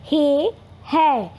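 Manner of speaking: हे short and closed, है open and stretched out slowly; हे versus है. Shown as a demonstration. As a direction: draw out हे then है to show the vowel change. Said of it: "हे और है में difference आपको पता चलना चाहिए". (हे और है को धीरे और अलग-अलग बोलिए)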